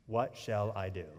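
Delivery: soft, low, inward "What shall I do?"; hushed, personal "What shall I do?"; quiet despair. Shown as a demonstration. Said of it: "haven't you been there".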